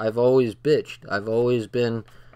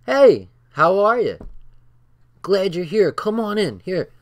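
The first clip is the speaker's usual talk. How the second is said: bright, higher and louder friendly greeting; cheerful welcome; 7 semitones higher, 4 dB louder than usual. Retold or imitated. A man in a wheelchair greets the carpet cleaner, higher, louder and cheerful. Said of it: "And he goes".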